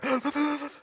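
high, stuttering mock sob; hup hup cry. Man crying beside his friend, exaggerated sobbing gasps. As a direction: imitate hitching crying gasps. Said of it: "I'm almost at the hup hup cry".

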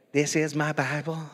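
lightly imitated Southern preacher; Joel Osteen. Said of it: "kinda got this high-pitched voice".

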